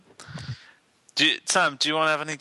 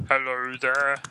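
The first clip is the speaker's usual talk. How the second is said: lightly put-on puppet voice; a half-hearted go at a frog puppet voice; weak frog impression; slower than usual. Shown as a demonstration. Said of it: "My impression's like".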